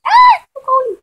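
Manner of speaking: high loud shivery cry; shivering cold. Acted. Kayla entering the icy barn, crying out high. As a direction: yelp at the cold, high and sharp.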